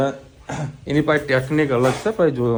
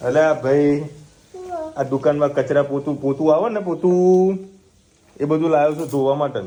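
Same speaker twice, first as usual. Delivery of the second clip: animated; 3 semitones higher, 5 dB louder than usual. Real, not acted. Animated talk to the child.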